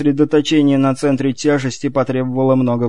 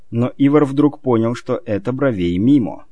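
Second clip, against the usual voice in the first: ordinary delivery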